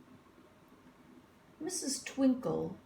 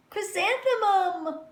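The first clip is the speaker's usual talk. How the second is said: mocking answer; 12 semitones higher, 6 dB louder than usual. A narrator's reading. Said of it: "was the answer".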